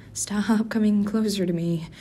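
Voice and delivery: normal talking voice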